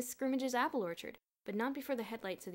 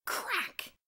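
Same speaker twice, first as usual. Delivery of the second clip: ordinary delivery, nothing marked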